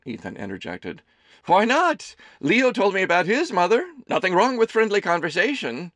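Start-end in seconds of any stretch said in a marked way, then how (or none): 1.5-5.9 high, loud doting mother voice; a fussing mother teasing her son; Amelie's voice. A narrator's reading. Mother serving her son lunch, high and fussing.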